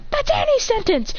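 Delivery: high-pitched acted objection; exasperated protest. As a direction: protest high, in play frustration.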